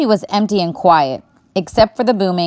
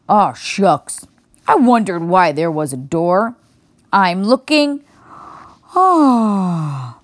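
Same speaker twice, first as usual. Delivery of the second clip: put-on sleepy old bear; slower than usual. A narrator's reading.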